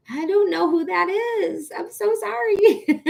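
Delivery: high, drawn-out sheepish confession; playful apology. Real, not acted. Streamer not knowing who Charlie Byrne is, sheepish apology. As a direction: sing out the apology, breaking into laughter.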